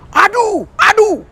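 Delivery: high mock groaning aduh; imitating a patient's groan; imitated groan. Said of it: "Ada orang sakit".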